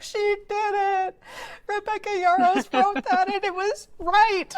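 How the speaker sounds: very high, stretched-out squeal; hammed-up emotional outburst; overjoyed wailing. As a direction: squeal it out, half crying with joy. Acts out bawling at plot reveal.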